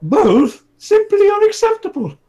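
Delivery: high, loud squeaky king voice; comic king refusing the price; outraged king. Acted.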